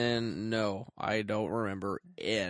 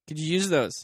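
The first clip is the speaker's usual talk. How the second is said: high mock suggestion; 6 semitones higher, 5 dB louder than usual. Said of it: "instead of saying".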